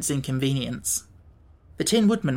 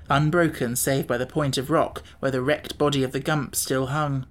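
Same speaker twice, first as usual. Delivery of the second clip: ordinary delivery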